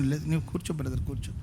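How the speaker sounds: near-hushed, soothing a heated man; hushed calming. Retold or imitated. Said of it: "ఆయన".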